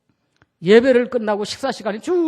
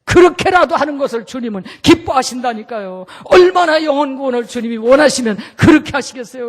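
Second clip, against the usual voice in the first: impassioned preaching; 2 semitones higher, 8 dB louder than usual. Acted. Preacher crying out.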